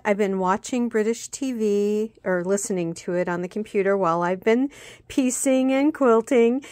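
ordinary talk, nothing marked

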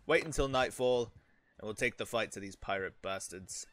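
usual speaking voice

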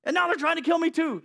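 loud, anguished Elijah pleading with God; desperate shout. Acted. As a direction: shout it like a cornered prophet. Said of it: "He replied".

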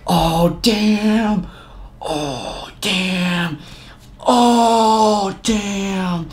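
high, drawn-out mock "oh damn" teasing; hyped onlooker. Narrator playing a hyped spectator at the standoff, high and teasing. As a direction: egg on the fight like a spectator.

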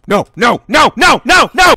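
string of no's building to a high shout; escalating panic. Real, not acted. Gamer watching the ride go wrong, escalating panic. Game going wrong, repeated no.

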